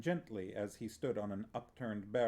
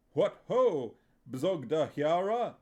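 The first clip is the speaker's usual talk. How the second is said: loud, high hearty hail; cheerful greeting; 6 semitones higher, 9 dB louder, slower than usual. A narrator's reading. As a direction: boom out a jolly greeting. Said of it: "he said cheerfully".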